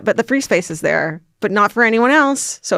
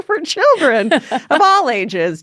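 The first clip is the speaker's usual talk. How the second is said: laughing; 5 semitones higher, slower than usual. Pretend. Laughing mock announcer tagline.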